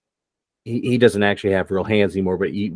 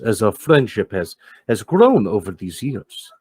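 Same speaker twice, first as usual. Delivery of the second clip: frustrated Max. Acted. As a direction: swallow the anger and offer the money. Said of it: "you see Max's frustration goes".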